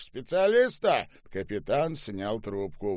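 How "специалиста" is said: high, loud officer's call; shouted order. A narrator's reading. General orders the captain to summon someone, shouted order. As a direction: call it out as a command.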